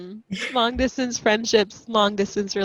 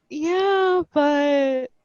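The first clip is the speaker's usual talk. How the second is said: high-pitched, hesitant mock protest; imitated sister objecting; 7 semitones higher than usual. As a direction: mimic a sister with a weak comeback. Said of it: "she was like".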